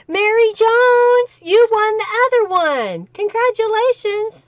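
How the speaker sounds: high, loud, celebratory call; excited winner announcement. Real, not acted.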